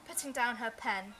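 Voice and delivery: ordinary delivery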